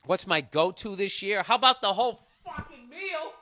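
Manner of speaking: loud, offended bragging; indignant. Acted. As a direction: act insulted and show off. Indignant answer to the caller.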